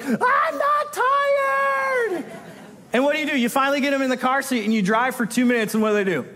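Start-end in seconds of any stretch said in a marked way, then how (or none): 0.0-2.2 put-on a very slow, much higher drawn-out child's shriek; screaming kid. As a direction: stretch "not tired" into a long wailing kid's yell. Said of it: "You're like".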